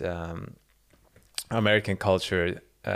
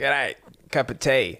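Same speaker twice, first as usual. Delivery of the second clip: put-on Australian accent; 5 semitones higher, slower than usual. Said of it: "imagine an Australian person".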